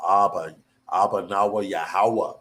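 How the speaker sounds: normal talking voice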